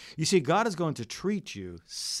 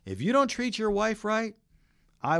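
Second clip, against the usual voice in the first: God's warning called out higher and louder; voicing God; 12 semitones higher, 6 dB louder than usual. Preacher voicing God warning husbands, raised and loud. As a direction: proclaim it like a divine warning. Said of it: "God's saying this".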